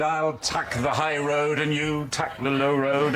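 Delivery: sing-song mock Scottish lilt, drawn out; Scottish accent. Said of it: "convincing Scottish accent".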